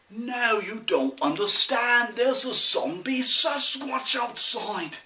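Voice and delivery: urgent warning. Acted. Son warns parents of zombie Sasquatch, urgently.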